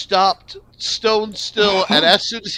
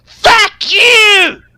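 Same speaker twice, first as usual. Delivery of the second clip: put-on a gnome yelling in a faintly female Scottish accent, much higher and louder; furious high Scottish yell, lightly accented; 10 dB louder, much slower than usual. Gnome cursing at the heap in a faint Scottish accent. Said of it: "in a faintly female Scottish accent".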